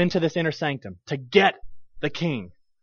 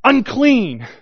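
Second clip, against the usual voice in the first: called out; 5 semitones higher, 6 dB louder than usual. Acted. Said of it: "somebody says".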